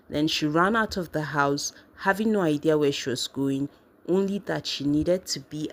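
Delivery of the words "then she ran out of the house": usual speaking voice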